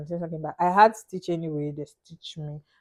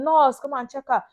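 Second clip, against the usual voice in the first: higher-pitched mock shout for the nurse; retold friend's call; 6 semitones higher than usual. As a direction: call for help, imitating the friend. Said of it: "My friend was shouting".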